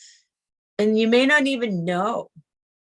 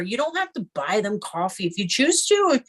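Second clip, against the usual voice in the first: ordinary delivery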